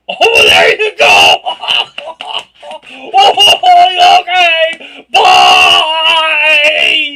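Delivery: high, loud cheerful escape; mock-bright flustered farewell; loud laughing goodbye. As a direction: shout the exit, laughing. Retelling her awkward exit.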